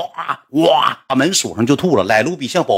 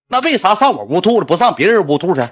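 higher, indignant arguing; a friend berating the KTV manager, higher and heated; heated complaint; 7 semitones higher than usual. Retold or imitated. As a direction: press the manager hard. Acted quarrel with the KTV manager.

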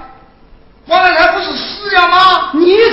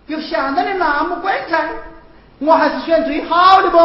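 normal talking voice

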